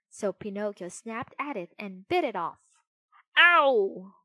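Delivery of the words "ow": loud, high "ow"; pained yelp. A narrator's reading. Bitten murderer losing a paw, loud pained yelp. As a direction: yelp sharply in pain.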